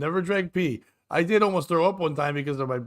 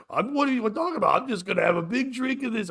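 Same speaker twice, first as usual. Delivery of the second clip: mock casual buddy; 7 semitones higher than usual. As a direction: laugh off the worry, yell it out. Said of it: "And Stu's like".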